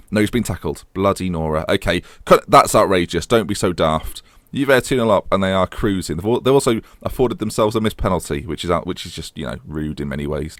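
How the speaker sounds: ordinary talk, nothing marked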